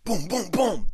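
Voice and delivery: high-pitched vocal booms; harder knocking imitation. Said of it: "You didn't knock loud enough".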